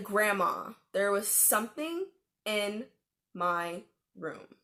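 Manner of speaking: firm, measured "there was something... in... my room"; emphatic insistence. Retold or imitated. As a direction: separate "in", "my room" with pauses. Repeats claim after disbelief.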